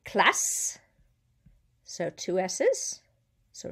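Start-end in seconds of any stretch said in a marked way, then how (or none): 0.1-0.8 CLASSE ending in a long "sss"; hissed S. Shown as a demonstration. Teacher giving a double-S example, hissed S. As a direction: hold the hiss closing classe. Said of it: "Two S's between two vowels has a S".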